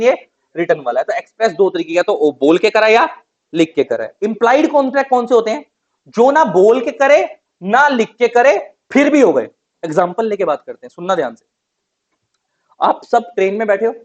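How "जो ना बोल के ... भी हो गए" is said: higher, louder, slow emphatic phrasing; emphatic definition. Shown as a demonstration. Teacher stressing the implied contract definition, slow and loud. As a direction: lean hard on each part. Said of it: "teacher stresses definition".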